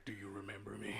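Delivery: a quiet vengeful rodent threat; whispered-low payback line; menacing hamster. Retold or imitated. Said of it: "he's like".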